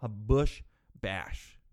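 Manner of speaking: lightly mocking the boomers saying a bush bash. Mimicking how boomers named the party, a slow low bush bash. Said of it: "That's what the boomers call 'em".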